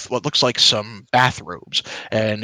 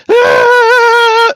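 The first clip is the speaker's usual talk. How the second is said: startled shout; 14 dB louder than usual. Acted. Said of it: "they're both kinda shouting".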